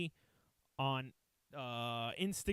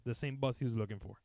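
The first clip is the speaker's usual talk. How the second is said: ordinary talk, nothing marked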